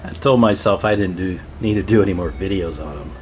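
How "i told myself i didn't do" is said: ordinary delivery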